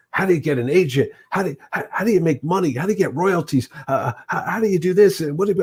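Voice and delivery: acting out eager badgering; pestering. Young artist at his idol in a signing line, badgering. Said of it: "I start badgering him about".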